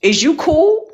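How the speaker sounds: high-pitched mock challenge; imitated challenge. Retelling a bully asking that girl, mock challenging. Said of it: "go and ask that girl".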